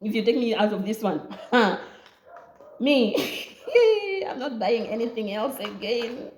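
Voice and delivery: half-crying, half-laughing plea; desperate prayer, laughter cracking the voice; laughing mock prayer. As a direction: beg the Lord while laughing. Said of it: "I was there like".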